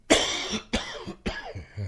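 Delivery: dry coughing fit. Real, not acted. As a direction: cough from feather dust. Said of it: "Excuse me".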